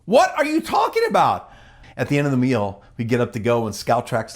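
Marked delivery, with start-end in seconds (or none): 0.0-1.5 bewildered. Real, not acted. Bewildered aside, high and louder.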